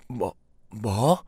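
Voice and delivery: slow, stammered disbelief; stunned surprise. Acted. Wife hearing the inheritance sum from her husband, stunned. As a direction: stammer it out, drawn long in shock. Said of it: "너무 놀라서".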